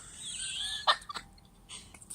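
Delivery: high airless giggle; squeaky wheezing laugh. Real, not acted. Laugh after ketchup line.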